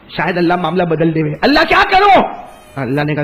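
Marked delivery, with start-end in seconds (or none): 1.4-2.2 a high, loud anguished plea to God; father's desperate call to God, loud and high; anguished cry. Acted. Abraham cries out to God.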